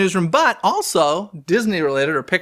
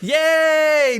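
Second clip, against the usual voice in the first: excited cheer; 11 semitones higher than usual. Real, not acted. Cheer at sneak peek news.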